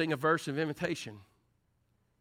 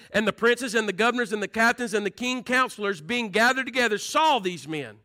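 preacher reading Daniel to the congregation, rising in force; scripture read with fervor; 7 semitones higher, 8 dB louder than usual. Acted. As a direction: read the Bible passage building to a shout.

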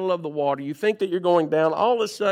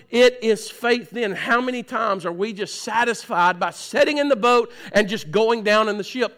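ordinary delivery

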